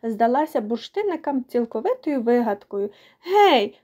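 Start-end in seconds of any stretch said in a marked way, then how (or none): 3.2-3.8 high, lively hail to get attention; raised-pitch storybook call. A narrator's reading. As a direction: call out briskly to a sulking friend. Character calls out to the girl.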